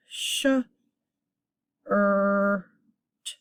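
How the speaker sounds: sh, er, t as isolated sounds with pauses; sounded out. Shown as a demonstration. Teacher sounding out a vowel R word, separate sh, er, t. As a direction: hold er between short sh and t. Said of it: "it's going to say er".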